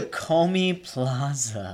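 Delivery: "Takome Plaza" stretched out slowly; slow prompt. Real, not acted. Prompting a friend who forgot, "Takome Plaza" slow and drawn out. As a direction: spell out "Takome Plaza" in long, deliberate syllables.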